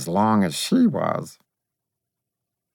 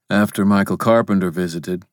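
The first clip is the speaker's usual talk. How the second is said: gruff man voice; faster than usual. Acted. Will's answer.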